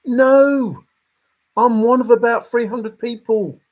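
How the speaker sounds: hammed-up indignant solver; mocking a searcher insisting they found it; mock protest. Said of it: "And you're like".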